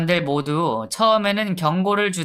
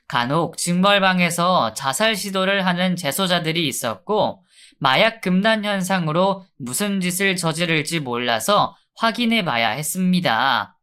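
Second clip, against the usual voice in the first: ordinary delivery, nothing marked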